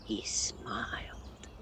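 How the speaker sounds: hushed slow whisper. A narrator's reading. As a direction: breathe it out ominously. Dread-filled narration beat.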